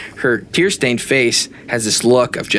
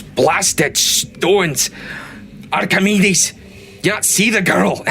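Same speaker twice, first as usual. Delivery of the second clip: a higher-pitched, lightly gruffed dwarf voice; gruff dwarf grumble; 7 semitones higher, much slower than usual. Acted. A cranky dwarf scolds Archimedes for missing the girl, in a gruff voice. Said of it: "And he goes".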